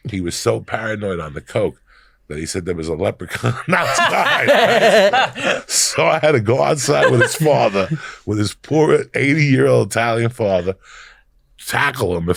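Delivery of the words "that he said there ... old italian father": laughing. Real, not acted. Laughing telling coke story.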